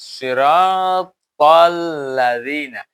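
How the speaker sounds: slow chanted সিরাতাল্লাযীনা with a full heavy সি and a bouncing ত; Quran recitation. Shown as a demonstration. Teacher showing the students the ত qalqala, slow chant. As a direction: chant it slowly and carefully like a qari. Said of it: "তাহলে বড় করে উচ্চারণ করবে".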